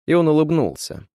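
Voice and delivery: ordinary delivery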